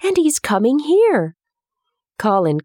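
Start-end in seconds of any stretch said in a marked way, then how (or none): 0.0-1.3 high, eager burst; can hardly contain the news; girl thrilled, leaning close. A narrator's reading.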